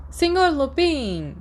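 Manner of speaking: bright, drawn-out high call across the ward; cheerful call. A narrator's reading. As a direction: call his name cheerfully, stretching it out slowly. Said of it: "Uma voz alegre ecoou".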